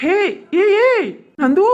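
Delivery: high, loud excited call; overjoyed shout. Acted. Man hears his girlfriend say I love you, shouting happily. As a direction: burst out with joy.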